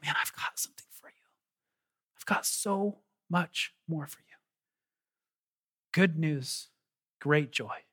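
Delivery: hushed tender whisper; whispering Jesus. Retold or imitated. Pastor voicing Jesus to the hesitant, soft whisper. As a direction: breathe it gently like a secret promise. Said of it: "But Jesus is like".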